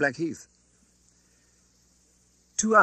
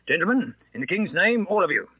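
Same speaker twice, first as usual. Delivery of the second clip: higher, urgent guard's command; hurried adjuration; 7 semitones higher, 3 dB louder than usual. A narrator's reading. Guard commanding the passengers in the King's name, urgent. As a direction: rush the order out in the King's name. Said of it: "With this hurried adjuration".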